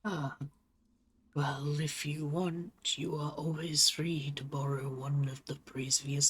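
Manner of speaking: lightly voiced wise old turtle, unhurried; slow, measured elder character voice; old turtle leader.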